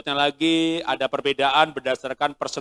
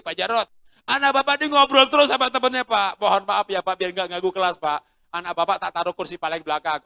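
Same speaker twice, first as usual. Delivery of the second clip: teacher complaining; 5 semitones higher, 6 dB louder than usual. Retold or imitated. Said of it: "Gurunya bilang begini".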